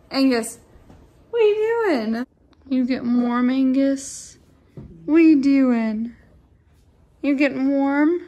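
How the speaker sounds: high, loud sing-song to the dog; pet talk. Real, not acted. Talking to her dog Angus.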